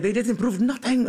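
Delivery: slow, high mock German accent; German accent slipping out. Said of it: "this German accent comes out".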